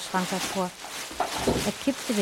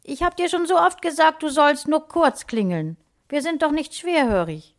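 high, raised scolding call; exasperated mum, pitched up and loud; mother calling out; 8 semitones higher, 4 dB louder than usual. A narrator's reading. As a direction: call through the house as an annoyed mother. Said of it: "rief die Mutter".

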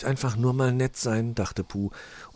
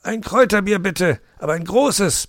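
higher, louder fox voice placing an order; ordering a drink; 12 semitones higher, 6 dB louder than usual. Acted. Mr Fox ordering a big herbal beer, loud and high. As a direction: order it firmly at the bar. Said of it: "bestellte er".

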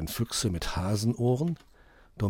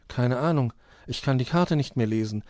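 usual speaking voice

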